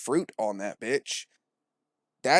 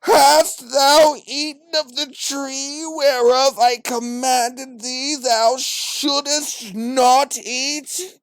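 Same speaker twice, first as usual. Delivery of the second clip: very high, booming, slow proclamation; mock pretentious; 11 semitones higher, 7 dB louder, much slower than usual. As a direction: bellow it slowly like overblown old English scripture. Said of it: "No one was ever like".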